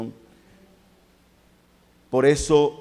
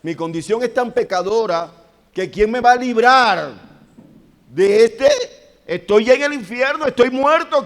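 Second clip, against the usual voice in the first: much higher and louder, desperate, pleading; prophet's anguished cry; 9 semitones higher, 8 dB louder than usual. Acted. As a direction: cry out like a man already in hell. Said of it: "el profeta está diciendo". (el profeta clama desesperado, agudo y fuerte)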